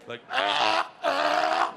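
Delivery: mock gagging gasps, the second one breathless. Comedian imitating a porn actress gagging with gasps. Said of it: "This was mascara running".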